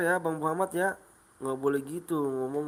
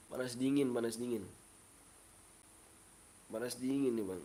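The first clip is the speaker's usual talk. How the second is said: quiet, worn-out sick voice; feverish and unwell; 3 semitones lower, 7 dB quieter than usual. Real, not acted. Streamer tells viewers he has hot-cold chills, feverish and weak. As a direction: speak softly through chills and a blocked nose. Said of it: "hidungnya pilek dua-duanya".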